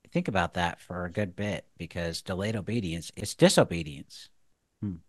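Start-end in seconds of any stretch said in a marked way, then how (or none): none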